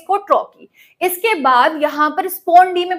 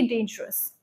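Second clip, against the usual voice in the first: Dangerous with more energy on the opening "डेन"; stress on first syllable; 4 semitones lower, 12 dB quieter, slower than usual. Shown as a demonstration. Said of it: "डेन पे आपको ज्यादा energy डालनी है".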